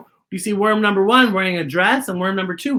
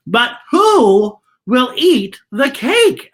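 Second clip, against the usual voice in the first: slow, louder bee question; 5 semitones higher, 6 dB louder, much slower than usual. Acted. Bees asking who eats the cake, slow and louder. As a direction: drag it out wondering. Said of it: "asked the bees".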